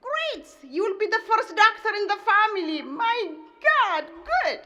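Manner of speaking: proud mother thrilled at doctor news; delighted mother. Retold or imitated. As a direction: gush with joy over your son. Mother delighted at son's plan.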